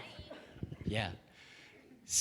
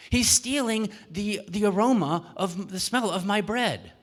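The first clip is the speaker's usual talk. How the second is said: aggrieved baker; 7 semitones higher, 4 dB louder than usual. Acted. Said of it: "What is he stealing".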